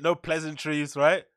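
ordinary delivery

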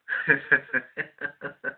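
self-mocking laugh. Real, not acted. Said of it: "How wrong was I".

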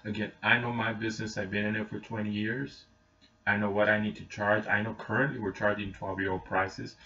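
ordinary talk

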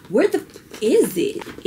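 sudden high yelp of frustration; exasperated outburst. Real, not acted. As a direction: burst out high as the search fails. Can't find eyebrow product.

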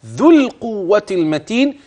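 "ذو القوة المتين" slowly, with the dh of "ذو" clearly voiced. Shown as a demonstration. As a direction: stress the dh in "ذو", go straight into the l. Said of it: "وذو الذال".

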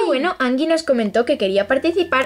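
ordinary talk

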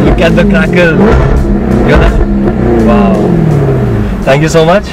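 loud thrilled shout; thrilled by the engine roar; excited. Real, not acted. Reacting to engine rev.

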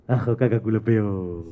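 put-on soft, low baby gurgle; baby babble. Baby brother in his cot, soft mock babble. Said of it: "su hermano Pablete".

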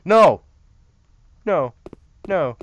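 loud, high "no" burst; alarmed shout. Real, not acted. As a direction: cry out suddenly in protest. Startled, blinded in game.

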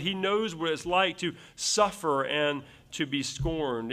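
usual speaking voice

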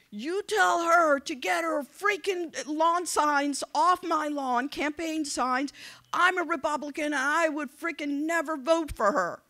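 high, loud mock tirade; angry caller. Angry voter ranting about lawn signs, imitated loud and high. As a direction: rant like an irate man, slowing on the demands. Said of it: "I get this angry phone call".

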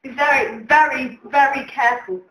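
usual speaking voice

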